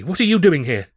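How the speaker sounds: high, loud startled cry; jolted awake and scared; anxious cry. Acted. Charlie waking to see his rival, crying out anxiously. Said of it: "he cried anxiously".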